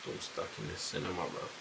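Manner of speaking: hushed, half-whispered grumble; under-breath mutter. Retold or imitated. Stuck in the cinema with the girl, whispered grumble. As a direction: mutter it to yourself so nobody hears. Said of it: "I'm like".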